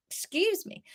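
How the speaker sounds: stifled burp. Real, not acted. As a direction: talk through a small burp. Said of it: "That was a tiny burp there".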